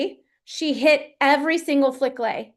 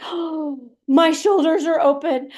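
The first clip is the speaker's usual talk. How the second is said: a touch of delighted discovery; amazed relief; 3 semitones higher, 3 dB louder, slower than usual. Retold or imitated. Athlete describing her body opening up, in mild wonder. Athlete feeling free, gasping.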